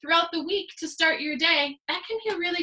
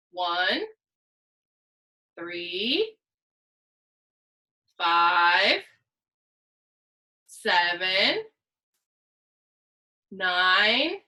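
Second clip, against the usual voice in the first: slow drawn-out counting; 7 semitones lower, much slower than usual. Shown as a demonstration. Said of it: "I'm gonna count the odds".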